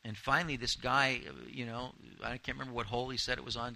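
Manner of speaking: usual speaking voice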